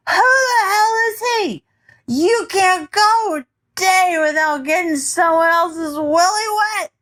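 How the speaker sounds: put-on high, raw drunken shout; drunken yelling. A narrator's reading. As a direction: yell it high and furious. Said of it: "He yelled again, this time louder".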